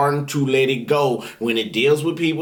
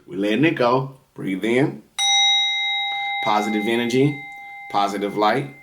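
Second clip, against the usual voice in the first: low-pitched, evenly paced meditation voice; guided breathing meditation; 4 semitones lower than usual. Real, not acted. Reader leading viewers in positive breathing, guided meditation voice.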